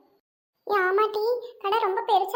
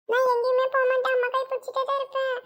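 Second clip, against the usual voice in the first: put-on a higher, small scared girl's promise; a little girl clinging to her mother; 3 semitones higher than usual. (அம்மாவிடம் பயந்த சிறுமியின் உயர்ந்த குரல்)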